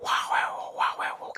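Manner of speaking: whispered mock spirit babble, lightly done; imitating the spirit's language. Ghost hunter shows viewers how the spirit spoke, whispered imitation. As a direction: breathe the strange syllables like an unseen spirit. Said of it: "dia menggunakan bahasanya kayak gini".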